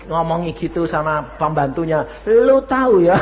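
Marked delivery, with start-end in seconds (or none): 2.3-3.1 rude servant talk. Retold or imitated. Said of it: "Coba kalau itu saudara majikannya".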